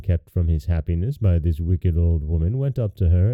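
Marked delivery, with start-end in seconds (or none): none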